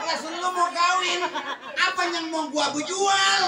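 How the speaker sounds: high, loud, slow laughing retort as the father; hammed-up father, half laughing; mocking father. Father telling his son there's nothing left to sell, slow laughing shout. Father mocks son's wedding request.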